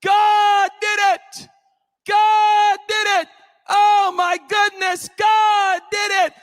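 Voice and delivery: full-force high holler of praise. Retold or imitated. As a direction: stretch GOD out in a high, loud shout. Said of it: "she started shouting even louder".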